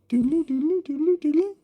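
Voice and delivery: high sing-song powering-down jingle; robotic shutdown tune. Acted. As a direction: chirp it like a toy winding down. Robot buddy shutting down noise.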